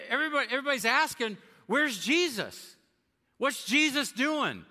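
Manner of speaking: the crowd urgently asking where Jesus is. Acted. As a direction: call out like a worried crowd searching.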